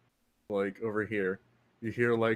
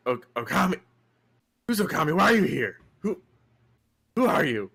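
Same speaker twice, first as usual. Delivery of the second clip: demanding; 5 semitones higher, 7 dB louder than usual. Acted. GM voicing NPC confronting stranger.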